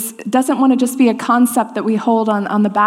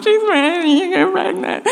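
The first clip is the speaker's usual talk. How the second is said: higher, sing-song mocking teasing; teasing girls; 8 semitones higher than usual. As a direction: tease her like giggling friends. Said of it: "snicker and laugh and be like".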